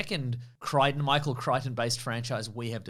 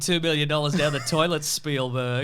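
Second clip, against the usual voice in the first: gloating villain laugh; 3 semitones higher, 5 dB louder than usual. Pretend. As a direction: gloat like a smug rival. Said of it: "he's like".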